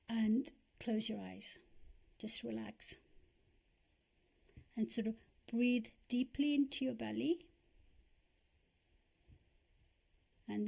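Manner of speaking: calm, unhurried guiding voice with long pauses; guided meditation. Acted. Teacher guiding listeners to relax their bodies, calm meditation voice. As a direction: ease them into breathing and relaxing.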